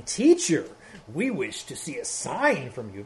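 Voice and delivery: hammed-up Pharisee, pitch swooping then sinking; lightly mock a Pharisee addressing a teacher. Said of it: "when I do my Pharisee voice".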